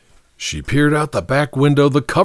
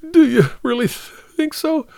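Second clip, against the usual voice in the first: very high, halting question; small hopeful catch in a crying voice; tearful hope. A narrator's reading. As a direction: ask through subsiding sobs. Tearful Martha wanting to believe him.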